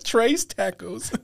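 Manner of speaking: drawn-out American-accented "tres tacos", high and laughing; gringo Spanglish. Shown as a demonstration. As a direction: stretch "trace tack-ohs" in a gringo accent. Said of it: "when I speak Spanglish".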